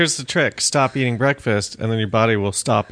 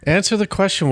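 higher-pitched, pressing demand; insistent demand; 8 semitones higher than usual. Real, not acted. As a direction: press the guest for an answer. Host insists on his question.

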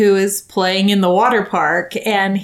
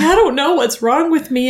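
retold tearful plea, higher pitch; a hint of crying through the words; 6 semitones higher than usual. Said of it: "crying and saying".

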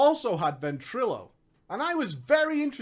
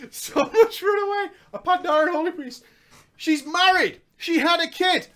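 much higher, put-on comic voice; high mock voice; 8 semitones higher, 4 dB louder than usual. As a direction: pitch it way up in mockery. Mock voice about her character.

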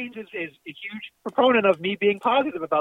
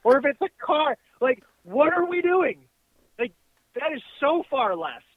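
raised, exasperated rant; yelling rant; 6 semitones higher than usual. Real, not acted. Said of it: "I can't yell like this".

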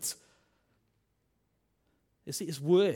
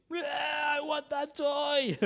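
put-on high, loud demanding kid wail; whiny child; 12 semitones higher, 4 dB louder, much slower than usual. Child yelling at dad for a toy in the supermarket, high whiny shout.

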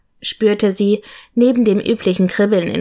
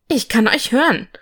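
mildly indignant protest; loud protest; 3 semitones higher than usual. A narrator's reading. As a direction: object with a lilting complaint. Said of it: "sagte Norman laut protestierend".

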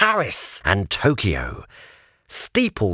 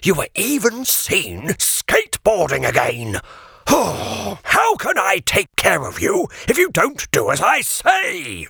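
fussy guardian scolding; 9 semitones higher than usual. Acted. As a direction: nag the runaway pig, rising to a cry at the end. Sir Regulus scolds Bertram.

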